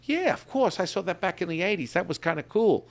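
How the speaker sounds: a client breezily agreeing; chirpy client. Retold or imitated. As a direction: play her casually remembering the film. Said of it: "she'll be like".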